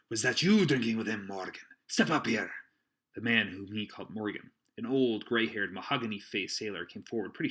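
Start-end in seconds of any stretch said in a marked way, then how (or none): 0.1-2.5 loud stern seaman; stern pirate voice. Acted. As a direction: call him up sternly. Silver summons Morgan.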